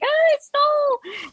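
very high mock wail; mock crying. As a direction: confess in an exaggerated whimper. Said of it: "I, like, start crying".

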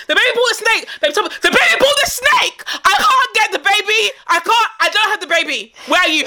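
high, laughing mock panic; panicked mom on the phone. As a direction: squeal it out like a frantic mother calling her husband. Friend acts out panicked phone call.

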